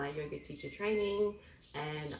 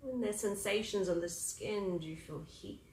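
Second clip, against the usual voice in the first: guided meditation; 6 dB quieter than usual. Acted.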